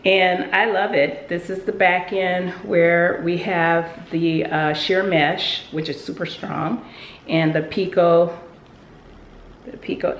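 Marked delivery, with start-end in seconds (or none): none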